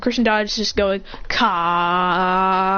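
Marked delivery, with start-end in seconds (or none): 1.3-2.7 long, held low chant; drawn-out attack chant. Acted. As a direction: hold the syllable like charging an attack. Chanting attack syllables while dodging.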